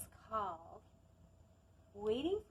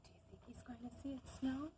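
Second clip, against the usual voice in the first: put-on fast hushed whisper to the kids; quick conspiratorial whisper; 2 semitones higher, 4 dB quieter, faster than usual. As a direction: whisper the question to the children quickly. Aside question to children.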